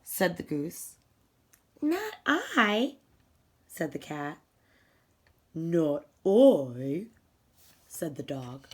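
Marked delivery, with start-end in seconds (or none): 1.8-3.0 higher, airy cat voice; a vain cat waving off the request; vain cat refusing. Acted. 5.5-7.1 dog voice, a bit lower, drowsy; a sleepy dog grumbling no; sleepy dog refusing. Acted.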